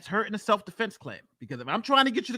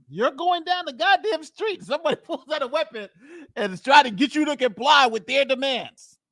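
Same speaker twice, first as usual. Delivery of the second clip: much higher, emphatic pitch; animated incredulity; 6 semitones higher, 4 dB louder than usual. Real, not acted. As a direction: pitch it way up to make them picture it. Animated put-yourself-there scenario.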